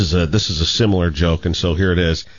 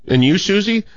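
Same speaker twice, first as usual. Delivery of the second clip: higher teacher voice; 11 semitones higher than usual. Acted. Teacher calls on Susie, higher voice. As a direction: call on the girl like a teacher.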